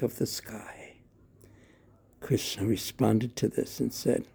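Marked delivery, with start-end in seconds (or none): 0.0-1.0 trailing off into a whisper; soft unvoiced hush. A narrator's reading. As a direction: breathe the last words. Whispered end of line.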